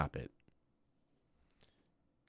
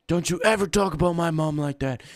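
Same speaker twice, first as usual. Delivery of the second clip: higher, louder stern warning; mock angry warning; 7 semitones higher, 3 dB louder than usual. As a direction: scold the chatter for insulting mom. Defending his mom, repeated.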